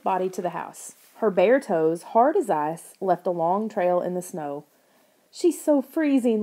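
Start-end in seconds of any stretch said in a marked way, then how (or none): 5.3-6.4 slow, higher tearful wail; pained, near tears; crying. A narrator's reading. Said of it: "Lauren cried".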